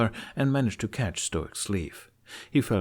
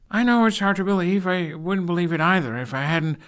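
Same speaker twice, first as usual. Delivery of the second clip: put-on much higher, louder, pleading young man; pleading Hiccup; 10 semitones higher, 6 dB louder than usual. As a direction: beg his father to believe him.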